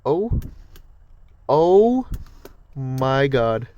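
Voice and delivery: sudden loud gasp of shock; shocked exclamation. Real, not acted. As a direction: blurt it out, startled. Something sudden happens in car.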